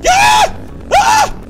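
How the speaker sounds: piercing high scream; shriek. Real, not acted. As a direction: shriek in sudden fright. Sudden scare.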